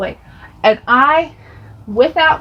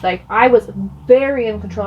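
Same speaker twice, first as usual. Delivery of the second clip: normal talking voice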